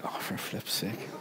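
hushed, breathy under-the-breath grumble; muttered aside. Retold or imitated. Brother grumbling quietly about the queue jumpers, muttered. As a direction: mutter it so only your brother hears.

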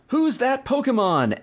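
high, drawn-out quiz announcer; mock TV announcer voice; announcer call. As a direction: call it like the show's host. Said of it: "comes back and says".

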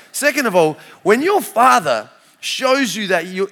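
ordinary talk

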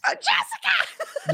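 mock-scandalized cry of her name; surprised shout. Real, not acted. Reacting to shocking remark.

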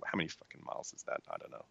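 dropped to a whisper under his breath; whispered aside. Real, not acted. Wondering about miles in a near whisper to himself. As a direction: mutter the aside to yourself.

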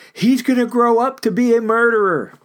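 high, loud, mocking little-sister voice; mimicking sister. Sister teasing her hot-tempered brother, high mocking voice. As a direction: taunt like a bratty sister predicting doom. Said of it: "always used to say".

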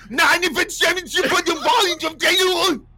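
high, loud mock German ranting; mock Hitler yell. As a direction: shout it like a hammed-up Hitler speech. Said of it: "I gotta yell like Hitler".

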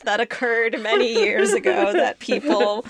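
giggling through the words; laughing. Real, not acted. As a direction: say it while laughing nervously. Laughing through content warning.

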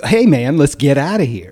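casual, exaggerated American accent. Showing how panicked Brits would really talk, casual American accent. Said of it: "They're gonna talk like us".

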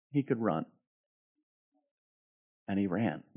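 soft, low drop after long pauses; hushed anticlimax. Real, not acted. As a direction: let the climax fall away into a quiet admission. Hushed anticlimax after preaching climax.

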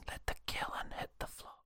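breathy whispered joke, barely audible. Pretend. To his co-host, teasing the line in a whisper. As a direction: whisper it like a secret punchline.

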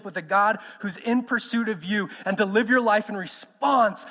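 full-voiced preacher pleading with the church; preaching. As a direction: preach it out to the congregation.

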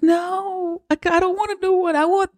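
put-on very high, pleading child's voice; little daughter refusing a new bunny; whining little girl. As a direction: beg like a kid who wants her bunny back. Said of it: "But she's like".

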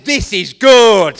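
slow, loud and satisfied; proud declaration. Retold or imitated. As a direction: savour it like a proud chef. Proud chef admiring his meal.

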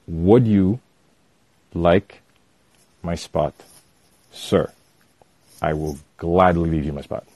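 measured and dead serious, word by word; changed, serious tone. Retold or imitated. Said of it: "And I change my tone".

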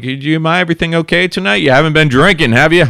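high, loud mock questioning; lightly imitated cop. Cops quizzing a young driver at night, mock interrogation.

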